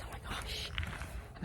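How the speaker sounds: breathy whisper of awe. Real, not acted. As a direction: whisper it in disbelief. Awed at having a baby.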